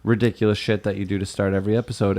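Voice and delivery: ordinary talk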